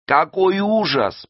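high, drawn-out wail; horrified moan. A narrator's reading. Саадат sees the burning sea, moaning. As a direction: moan it high and slow in horror. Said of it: "простонала Садат".

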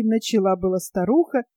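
ordinary delivery

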